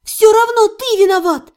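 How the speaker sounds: high, loud insistence; stubborn high-pitched blame; unyielding. A narrator's reading. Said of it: "не сдавалась я".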